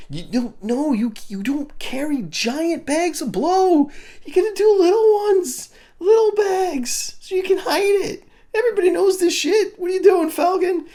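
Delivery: very high, squeaky mock outrage; exasperated falsetto scolding. Reviewer scolding Falcon over his giant bag, squealing exasperation. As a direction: scold him in a strained, incredulous squeal.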